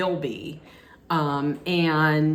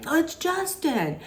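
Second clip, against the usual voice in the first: much higher, delighted cry; mock excited greeting; 11 semitones higher than usual. The guys spotting Justin on the steps, excited. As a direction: greet him like an old friend.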